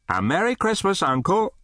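high, hearty festive greeting; cheerful. Acted. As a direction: call out gladly in a lifted voice. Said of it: "cried a cheerful voice".

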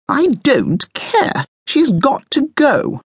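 high, slow, emphatic refusal; high-pitched, drawn-out insistence; stubborn and bossy. A narrator's reading. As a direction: put your foot down, stretching each word high. Bossy Coco overrules a protest.

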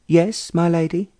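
a prim exchange operator; telephone operator. A narrator's reading.

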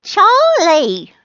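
high, loud shout of his own name. A narrator's reading. Charlie repeats his name to Rose, high shout. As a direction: shout it high to get through. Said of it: "Charlie shouted".

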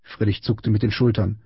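ordinary delivery, nothing marked

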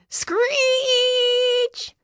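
very high squealing screech; tire screech. Acted. Car stuck on the snowy hill, squealing screech. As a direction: mimic tires squealing on ice.

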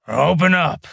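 gruff order through a door hatch; growled. A narrator's reading. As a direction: bark the order at the door guard. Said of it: "he growled".

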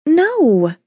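high, pouty bratty "No"; bossy little princess. A narrator's reading. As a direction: stamp it out like a spoiled child. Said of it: "She stomped her foot and pouted".

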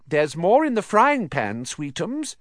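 high, doting mother's voice; Aunt Petunia. A narrator's reading. Mother doting on her massive son, cooing. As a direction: coo it fondly to a darling son. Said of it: "turning misty eyes on her massive son".

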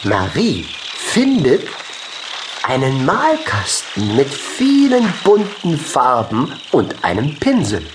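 usual speaking voice

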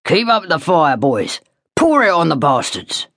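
lightly voiced sergeant major bellowing; a hint of a barking sergeant major; harsh shouts. A narrator's reading. Sergeant major urging the men to keep firing, mild shout. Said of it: "harsh shouts of the Sergeant Major".

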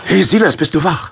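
loud, high, urgent call to a sleeping friend; urgent wake-up. Acted. Paulus waking Silas at night, urgent and excited. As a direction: shake him awake with the news.

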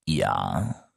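a long, slow, deep ja; drawn-out, knowing yes. A narrator's reading. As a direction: stretch the word out, weighing it. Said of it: "antwortete er gedehnt".

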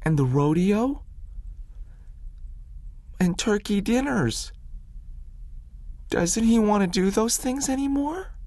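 put-on high, pleading boy's voice; a small boy begging his grandfather to explain; lightly voiced young boy, wistful; little Willie's voice. A narrator's reading.

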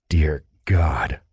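weary low aside; breathed. A narrator's reading. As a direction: sigh it out under your breath. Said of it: "Thane breathed".

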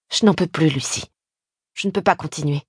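low, guilt-laden confession; guilty. A narrator's reading. Husband giving up before his sister-in-law, guilty and subdued. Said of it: "Il les disait comme s'il se sentait coupable".

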